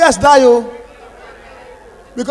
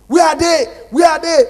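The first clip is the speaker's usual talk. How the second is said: loud, higher battle cry; rallying shout; 6 semitones higher, 5 dB louder than usual. Retold or imitated. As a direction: call the boys out loud. Boys answering the rally call.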